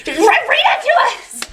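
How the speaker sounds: high-pitched mock shout through laughter; laughing, squealed urgent demand; mock excited demand. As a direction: imitate someone clamoring to hear the news. Retold impatient listener demanding news.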